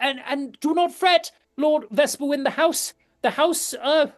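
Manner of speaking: high, loud, anxious noble lady; reassuring lady. Acted. Lady reassures Lord Vesperwind, high and loud. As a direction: hurry to calm him down.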